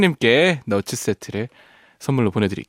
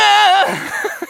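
a high bright burst of laughter; high laugh after singing; 3 dB louder than usual. Real, not acted. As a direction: laugh at your own failed note. Laughs after straining high note. (높은 웃음)

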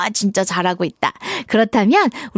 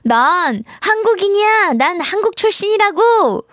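much higher, proud assertion; confident declaration; 9 semitones higher than usual. Acted. Teacher models saying one's nationality, confident declaration. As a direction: state it firmly and boldly. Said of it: "당당하게 말할 수 있어야 되겠죠".

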